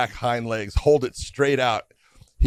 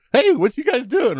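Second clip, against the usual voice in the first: put-on high curious kid voice, much higher; 13 semitones higher, 3 dB louder than usual. Son wandering up to dad and Scott, high curious call. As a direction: play the young son bopping in, chirpy and high. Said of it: "He goes".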